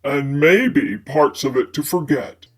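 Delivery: low male character voice; man's voice. A narrator's reading. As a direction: voice Fitz low and smooth. Fitz's teasing aside.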